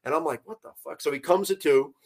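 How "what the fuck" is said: very soft retold reaction; hushed disbelief. Angler turns to see his friend half submerged, hushed disbelief. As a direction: say it under your breath, stunned.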